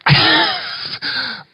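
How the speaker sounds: high burst of laughter; can't hold back a high laugh; real laugh. Real, not acted. Speaker laughing at what the audience thinks, high burst. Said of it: "I'm laughing 'cause I know what you're thinking".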